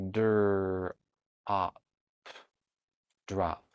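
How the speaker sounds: segmenting "drop" into dur, op, then blending; sounding out drop. Shown as a demonstration. Teacher modeling how to sound out "drop" for a child. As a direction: break "drop" into its sounds, then join them. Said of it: "Let's give this one a try".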